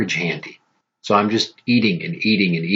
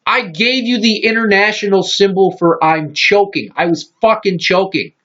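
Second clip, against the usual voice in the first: indignant yell; 10 semitones higher than usual. Retold or imitated. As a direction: shout it like she ignored you choking. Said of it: "I'm like".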